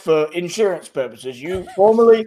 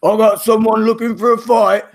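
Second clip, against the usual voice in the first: lightly voiced half-orc bruiser, a little higher and louder; a hint of a swaggering half-orc fighter; tough half-orc; 4 semitones higher, 4 dB louder than usual. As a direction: sound like a fighter spoiling for a brawl. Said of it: "And he comes over and says".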